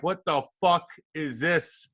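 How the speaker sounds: slow, drawn-out mock disbelief; a veteran sizing up the rookie, slowly; unimpressed veteran. Imitating veteran teammates seeing a rookie in sweatpants, slow disbelief. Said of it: "they're like".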